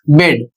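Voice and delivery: "बेड" with E said as a short ए; short e pronunciation. Shown as a demonstration. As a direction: model "बेड" as one word with a short eh vowel. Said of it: "ई का पहला उच्चारण होता है ए".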